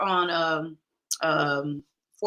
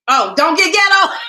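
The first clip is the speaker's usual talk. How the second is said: evil voice; 8 semitones higher, 9 dB louder than usual. Retold or imitated. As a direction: shout it like a cartoon villain, higher and louder. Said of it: "in his evil voice".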